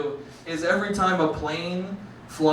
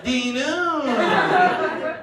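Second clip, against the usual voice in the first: drawn-out Simlish gibberish, climbing higher; exaggerated Simlish nonsense words; 7 semitones higher, much slower than usual. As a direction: answer in made-up Simlish game-speak. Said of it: "The only thing I can speak fluently is Simlish".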